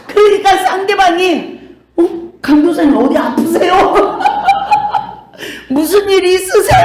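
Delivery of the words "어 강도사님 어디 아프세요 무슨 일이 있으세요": high, fast, laughing surprise; playing the worried caller through laughter; mock startled caller. Caller startled by the gentle voice, laughing. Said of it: "막 이러더래요".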